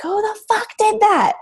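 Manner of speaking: high, furious mock yell from the panicked dad; retold angry yell. Father yelling at everyone after his baby is burned, high mock yell. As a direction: bark it out like a frightened, angry father. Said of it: "he's screaming".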